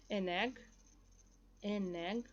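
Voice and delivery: AN EGG run together as "a-negg"; linked sounds. Shown as a demonstration. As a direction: join the N of AN onto EGG. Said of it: "tô juntando o som também".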